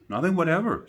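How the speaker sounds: firm denial. A narrator's reading. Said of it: "said Alice".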